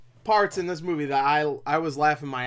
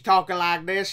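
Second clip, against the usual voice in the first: mock Southern country accent; 3 semitones higher, 4 dB louder than usual. Reviewer showing how the actor talks, mock country accent. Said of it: "still has that accent".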